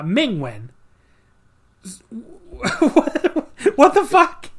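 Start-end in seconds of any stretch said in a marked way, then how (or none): 1.8-4.5 high, laughing what the fuck; incredulous disbelief. Real, not acted. Reacting to an absurd DQ finish, high incredulous outburst. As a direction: burst out in laughing disbelief, pitched way up.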